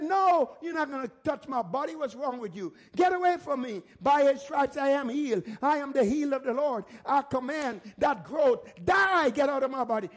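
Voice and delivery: commanding, emphatic rebuke; with authority. Shown as a demonstration. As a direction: order the sickness out forcefully. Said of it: "You gotta speak with authority".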